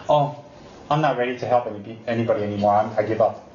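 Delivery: low, lightly voiced tired nun giving up; mock weary nun. Teacher mocking a famous nun quitting, mild weary voice. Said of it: "the great Taiwanese nun say".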